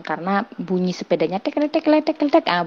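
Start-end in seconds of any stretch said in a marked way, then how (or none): 1.4-2.7 high quick tek tek clicks; imitated bike clicking. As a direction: mimic the clacking bike. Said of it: "bunyinya gitu kan". (tiruan bunyi sepeda tek tek dengan nada tinggi)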